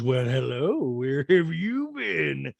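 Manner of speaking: drawn-out, put-on greeting; really weird voice. Guest greets Kyle's sister, in a weird drawn-out voice. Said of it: "she's using that really weird voice".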